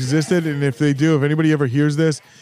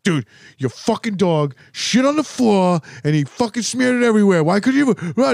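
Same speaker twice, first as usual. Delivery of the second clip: ranting brother; 6 semitones higher than usual. Retold or imitated. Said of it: "and he's like".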